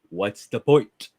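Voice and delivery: ordinary delivery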